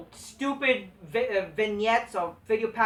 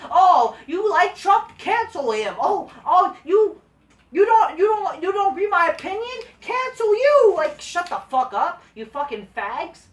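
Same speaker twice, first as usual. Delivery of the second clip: high, loud whiny mock outrage; shrill-free squeaky parody of an offended fan, hammed-up; mocking cancel mob; 10 semitones higher, 10 dB louder than usual. Ranting to viewers, mimicking a cancel mob in a hammed-up whiny voice.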